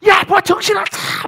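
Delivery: high, loud shout to snap out of it; sudden high-pitched holler; acted frantic yell. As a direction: bellow it at someone losing their head. Acted yell amid sea panic.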